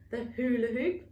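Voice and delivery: ordinary delivery, nothing marked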